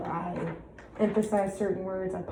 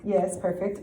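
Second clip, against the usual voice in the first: usual speaking voice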